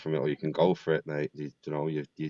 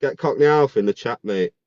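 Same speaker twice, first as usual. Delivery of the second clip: loud call to the chat; rally the viewers with a shout; calling out again; 4 semitones higher, 7 dB louder than usual. Real, not acted. Calling out for Cockney Alf.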